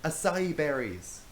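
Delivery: slow excited shout of discovery. Acted. Rachel spotting berries for friends, slow excited shout. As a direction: call out the find, drawn out. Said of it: "shouted Rachel".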